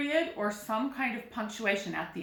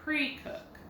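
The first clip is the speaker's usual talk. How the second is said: "Pre-cook" with a higher-pitched "Pre", falling on "cook"; stress on pre; 6 semitones higher, 3 dB quieter than usual. Shown as a demonstration. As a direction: demonstrate the accent on the first syllable of "Pre-cook". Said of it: "pre is getting the accent".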